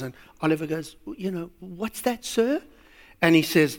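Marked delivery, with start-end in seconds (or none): 1.2-2.6 soft, meek questioning voice; timid orphan boy. Acted. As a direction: ask very softly, rising on "sir". Oliver timidly answers the gentleman.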